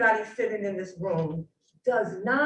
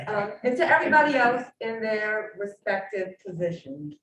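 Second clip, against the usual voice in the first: raised, carrying classroom voice; teacher voice. Real, not acted. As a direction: speak up like a teacher. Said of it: "Use that teacher voice".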